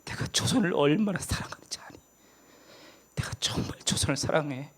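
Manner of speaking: hushed tender whisper of God; the Lord whispering his love for Joseon. Pretend. God speaking to his wife.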